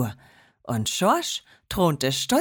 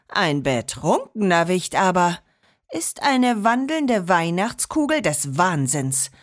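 usual speaking voice

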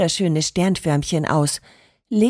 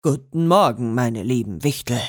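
low, full-voiced teacher greeting; sonorous call; 9 semitones lower than usual. A narrator's reading. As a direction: call it out deep and resonant like a proud master baker. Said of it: "rief Enzo mit sonorer Stimme".

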